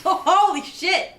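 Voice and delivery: loud, high, drawn-out laughing exclamation; laughing disbelief. Real, not acted. Friend reacting to grandma's apple tree story, loud laughing shock. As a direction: burst out laughing in slow disbelief.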